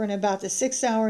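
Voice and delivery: normal talking voice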